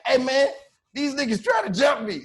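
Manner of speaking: high, loud, urgent plea; alarmed shout. Retold or imitated. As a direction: yell for help mid-fight. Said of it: "I'm like".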